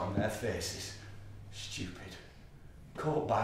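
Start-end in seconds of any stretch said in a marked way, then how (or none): none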